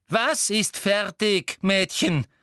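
loud, high, slow interrogation; insistent demand. A narrator's reading. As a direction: demand it from the girl word by word. Umbridge presses the girl.

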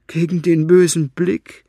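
high, loud mocking retort. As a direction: sneer it at him. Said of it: "spottete Fenoglio".

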